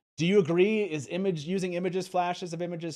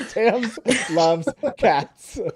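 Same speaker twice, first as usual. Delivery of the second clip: drawn-out words, slow and louder; slowly repeated with a laugh; 7 semitones higher, 9 dB louder, much slower than usual. Shown as a demonstration. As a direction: stretch each word out deliberately. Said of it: "that came out very slowly".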